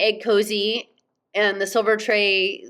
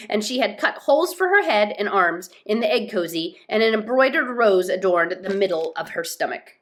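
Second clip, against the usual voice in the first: ordinary delivery, nothing marked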